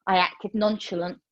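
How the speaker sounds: usual speaking voice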